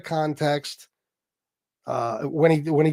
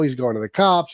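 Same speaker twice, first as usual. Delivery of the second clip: usual speaking voice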